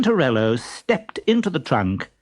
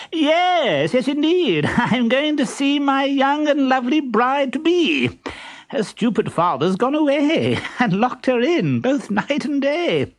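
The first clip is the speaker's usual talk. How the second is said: very high, pompous suitor voice; silly pompous voice; 12 semitones higher than usual. Acted. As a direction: play a vain fool, high and squeaky. Stentorello boasting.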